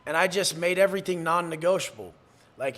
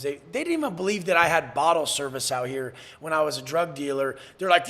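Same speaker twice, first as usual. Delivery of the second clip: normal talking voice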